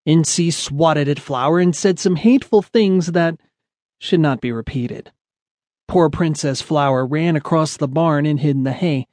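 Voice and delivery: usual speaking voice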